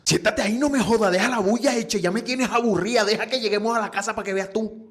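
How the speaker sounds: hammed-up granny telling off the brat; furious grandma. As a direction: scold the grandson like an angry old grandma. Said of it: "le tira unas palabras muy terapéuticas".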